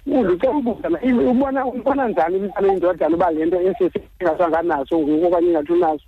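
phone call. Real, not acted. Caller on radio phone line.

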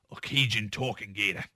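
put-on a quiet, light Australian accent slipping into "a Cajun talking gator". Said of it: "that's an Australian accent you just used".

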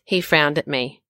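usual speaking voice

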